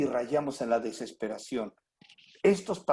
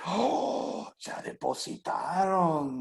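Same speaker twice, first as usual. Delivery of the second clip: mock delighted surprise; 3 semitones higher, much slower than usual. Payday deposit seen.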